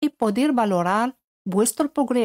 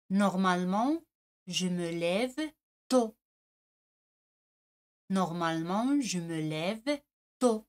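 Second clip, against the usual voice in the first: soft French model; 2 semitones lower, 8 dB quieter than usual. Shown as a demonstration. Teacher softly reads the answer to the exercise. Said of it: "answer read softly".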